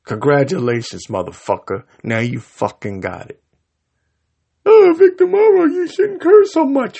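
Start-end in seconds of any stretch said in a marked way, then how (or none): 4.7-7.0 much higher, louder prissy mock voice; mocking whiny critic. Host mocks viewers who scold his swearing, in a high whiny voice. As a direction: squeak it out like a scolding prude.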